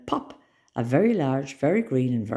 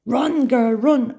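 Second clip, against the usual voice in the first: high, loud urgent squeak; panicked squeak; 10 semitones higher, 8 dB louder, much slower than usual. A narrator's reading. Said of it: "I squeaked to myself".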